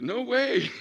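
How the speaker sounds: high, loud exclamation breaking into laughter; gleeful refusal. Retold or imitated. Mom refusing to sit back down, gleeful refusal. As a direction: burst it out in high-pitched delight.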